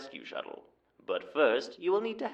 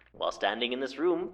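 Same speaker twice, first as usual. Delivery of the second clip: usual speaking voice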